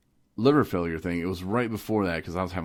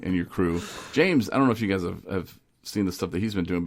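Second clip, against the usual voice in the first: normal talking voice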